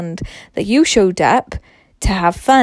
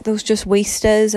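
usual speaking voice

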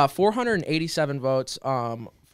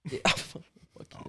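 whispered; 6 dB quieter, slower than usual. Real, not acted. Laughing jab at friend.

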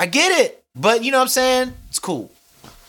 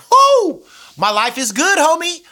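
thrilled; 12 semitones higher, 6 dB louder than usual. Retold or imitated. Spotting his idol on the street.

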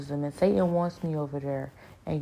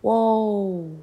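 higher, louder, taken aback whoa; stunned; 6 semitones higher, 8 dB louder than usual. Retold or imitated. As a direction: caught off guard by the rebuke. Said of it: "And I was just like".

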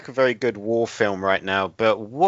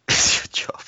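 whispered through a laugh. Real, not acted. Teasing the co-host about piracy, whispering and laughing.

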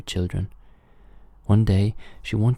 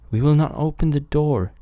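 put-on high, louder little kid voices; kids crying out; 9 semitones higher, 5 dB louder than usual. A narrator's reading. Kids refuse the wolf at the door, crying out high. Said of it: "cried they".

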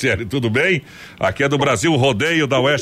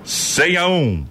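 slow suspense call; 3 semitones higher, much slower than usual. Acted.

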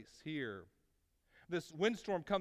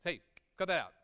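mock stern dad; 4 dB louder, faster than usual. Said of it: "It's like a dad to the kids".

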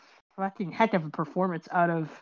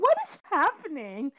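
mock bewildered question, a touch higher; baffled disbelief; 6 semitones higher than usual. Viewer reacting to a strange movie, baffled. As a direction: ask it like you can't believe the screen. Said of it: "we're like".

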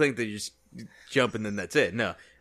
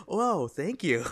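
all smiles; 6 semitones higher, slower than usual. Retold or imitated. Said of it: "all smiles".

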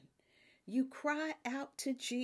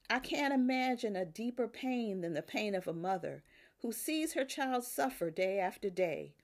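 ordinary delivery

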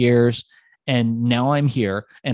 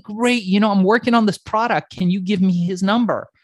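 eager caller; 8 semitones higher, 4 dB louder than usual. Retold or imitated. His own eager phone pitch.

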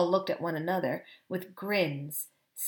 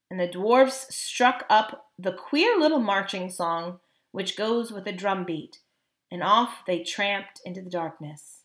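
ordinary delivery

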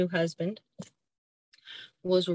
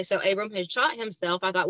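normal talking voice

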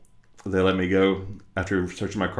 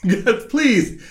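high pleading; 13 semitones higher, 3 dB louder than usual. Retold or imitated. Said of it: "And I'm like".

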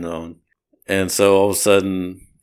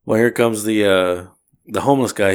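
ordinary talk

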